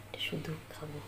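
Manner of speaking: hushed secret whisper. Real, not acted. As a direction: whisper it so no one hears. Secretly eating ice, hiding it.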